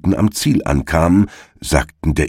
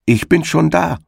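high, sing-out call without extra volume; wife's high-pitched call; 11 semitones higher than usual. A narrator's reading. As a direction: hail the runner in a much higher voice. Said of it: "rief ihm die Frau des Igels entgegen".

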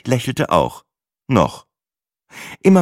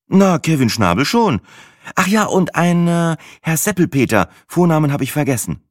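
higher, breezy and unbothered; Sina, young woman; 9 semitones higher than usual. A narrator's reading. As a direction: Sina shrugging it off. Narrator voices Sina.

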